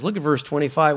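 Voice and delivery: ordinary talk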